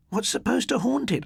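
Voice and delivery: put-on young girl asking, high and curious; lightly voiced girl. A narrator's reading. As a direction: ask it like an eager schoolgirl. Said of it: "asked her friend Mavis Tucker".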